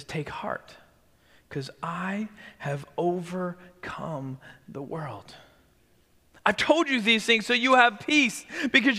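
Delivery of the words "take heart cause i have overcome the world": hushed whisper. Acted. Quoting Jesus's comforting promise.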